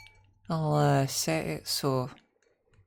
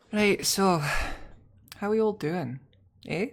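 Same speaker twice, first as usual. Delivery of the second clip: lightly put-on Scottish greeting; a jokey Scottish hello to chat; playful Scottish accent; 4 semitones higher, 3 dB louder than usual. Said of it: "why are we Scottish again".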